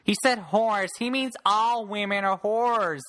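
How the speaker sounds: high, wailing online complainer; mock outraged people. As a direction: imitate scandalized commenters, slowing into a drawn-out last line. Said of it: "people's like".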